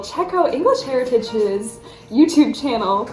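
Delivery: normal talking voice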